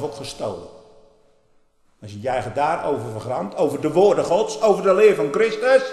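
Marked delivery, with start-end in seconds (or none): 3.6-5.8 rising louder and higher into warning; building rebuke. Real, not acted. Preacher warns the congregation against doctrinal error, rising loud. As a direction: climb into a fiery warning.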